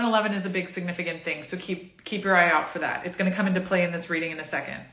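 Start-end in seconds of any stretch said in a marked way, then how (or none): none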